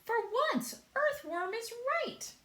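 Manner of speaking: high, loud excited voice; high centipede. A narrator's reading. Centipede exclaiming that the earthworm is right for once. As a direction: squeal the agreement.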